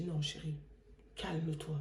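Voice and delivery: soft, low soothing aside; calming. Retold or imitated. Wife calming her angry husband, soothing. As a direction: imitate a wife quietly settling him down.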